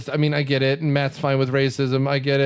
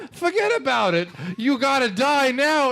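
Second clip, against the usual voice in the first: mock Brooklyn tough guy; 8 semitones higher than usual. Mocking the movie's Brooklyn character, hammy cartoon threat. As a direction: lay on the Brooklyn gangster bit. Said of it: "him going".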